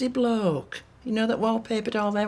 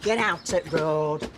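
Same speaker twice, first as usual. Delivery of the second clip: usual speaking voice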